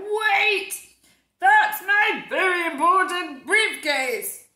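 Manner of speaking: high, loud, slow shout. Acted. Mister Brown shouting after the ice cream seller.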